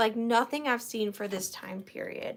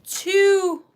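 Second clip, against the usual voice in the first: odd drawn-out two; 6 semitones higher, 8 dB louder than usual. Pretend. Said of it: "why I said it like that".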